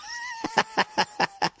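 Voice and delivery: high shrieking cackle; shrieking laugh. Real, not acted. Laughing at his own banker joke, shrieking cackle. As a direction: burst into a shrill laugh.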